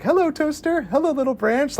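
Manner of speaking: high-pitched mock hello to little things; cutesy imitated hello; sing-song greeting. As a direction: lilt up high, imitating a character's chirpy greeting. Acts character greeting objects.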